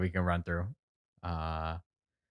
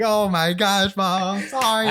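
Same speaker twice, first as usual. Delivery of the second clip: a hint of sheepish apology; 11 semitones higher, 6 dB louder, slower than usual. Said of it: "I was like".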